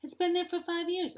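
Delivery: high, loud, exasperated comeback; exasperated wife. Acted. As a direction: shout back the obvious, pitch way up. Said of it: "I'm like".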